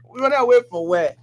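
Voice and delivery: incredulous. Real, not acted. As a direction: toss it back in disbelief.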